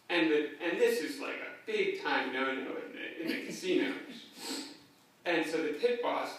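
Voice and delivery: usual speaking voice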